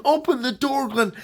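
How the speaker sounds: high-pitched plea acted as a yell; mock yell through a door, pitch well up; retold yelling. Trapped boy to his friend outside, retold yelling. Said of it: "Bobby was yelling".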